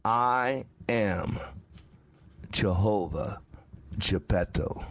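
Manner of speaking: slow solemn inner voice of the Lord; voice of God in his head. Retold or imitated. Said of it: "I heard it again".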